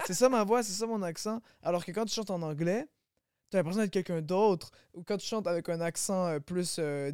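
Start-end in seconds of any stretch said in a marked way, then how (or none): 0.1-1.4 higher-pitched mock disbelief; mock dismay. Artist imitating someone hearing himself on video, mock dismay. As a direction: act out cringing at your own voice.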